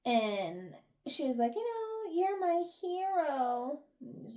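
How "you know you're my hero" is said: high, drawn-out mock adoration; mock gushing admirer. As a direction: gush it slowly, pitched up high. Acted gushing thanks to hero.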